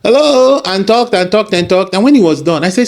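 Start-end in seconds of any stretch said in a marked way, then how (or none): 0.0-0.6 phone greeting. Retold or imitated. Man answering a phone call.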